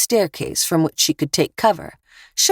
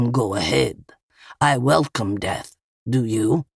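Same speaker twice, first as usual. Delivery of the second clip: low-voiced taunt from the robber; 5 semitones lower than usual. A narrator's reading. Robber pressed against Eva's carbine dares her, low taunt. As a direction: dare them, pitched well down. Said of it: "he taunted".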